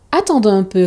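usual speaking voice

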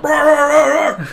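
loud, high rah-rah barking like a charging dog; imitated dog barking. Retelling his dog charging the bikers, imitated loud barking. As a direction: bark it out like an angry dog.

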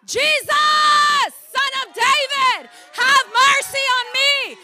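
high, slow, loud plea; crying out. Acted. Preacher acts out blind beggar begging Jesus for mercy, crying out. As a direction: stretch each word in a desperate shout. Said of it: "That's what he cried out".

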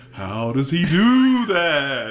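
very slow, drawn-out ghostly question; mock-spooky wonder. SpongeBob doing a finger trick to scare the kid, slow mock-spooky drawl. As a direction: stretch every word out in an imitated eerie voice. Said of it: "and he goes".

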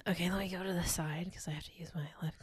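quick whispered aside; whispering. Real, not acted. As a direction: whisper it to yourself. Quiet aside during her turn.

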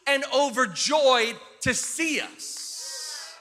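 high, loud, emphatic build; slow down and punch each phrase as it peaks; rousing, drawn-out emphasis; worked-up preaching. Real, not acted. Preacher builds on children's excitement.

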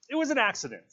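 high, loud burst; sudden. A narrator's reading. Piggy seizes on an excuse, sudden. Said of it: "said Piggy suddenly".

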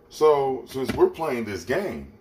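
lightly acted man reasoning it out; men rationalizing. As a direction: think aloud as a scheming man, lightly. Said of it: "So men are like".

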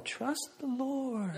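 soft, slow, hushed trust the Lord; calm reassurance. Retold or imitated. Wife calming her panicking husband, soft and slow. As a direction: soothe him gently, almost a whisper. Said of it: "Renee's going".

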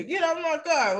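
high, mock telling-off; acted high-pitched complaint. Acting out telling kid off.

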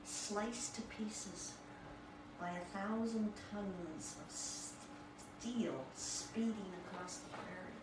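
slow, hushed re-read of a favorite line; quietly relishing the phrase; savoring the words. Shown as a demonstration. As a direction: linger on it in awe. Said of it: "I thought that was absolutely stunning".

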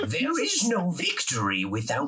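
put-on slow, high old man voice; grumpy old man. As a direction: wise old mentor, slowly. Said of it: "gotta think of a character voice".

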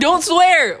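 high, loud reprimand; sudden scolding. Real, not acted. Scolding her cousin for nearly swearing, high and loud. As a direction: cut in sharply to stop the swearing.

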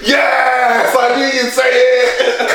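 high, drawn-out mock cheer; retold triumph. Guy hearing the answer he expected, imitated triumphant cry. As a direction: celebrate like you called it. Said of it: "the guy just goes".

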